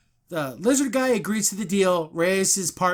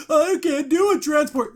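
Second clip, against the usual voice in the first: higher, whiny mock protest; whiny doctor; 8 semitones higher, 4 dB louder than usual. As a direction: whine as if a button were too hard. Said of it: "And she's like".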